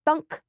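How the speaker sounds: loud, high thunk of a head hitting wood; thunk sound effect. Acted. Matilda hitting her head on the ceiling, a loud thunk. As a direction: punch out the bump sound.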